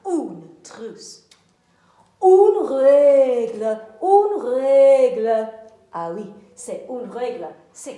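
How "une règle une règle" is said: much louder, slow and deliberate; loud slow model word. Shown as a demonstration. Teacher modelling a word loudly for pupils. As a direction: announce the word for the class to copy.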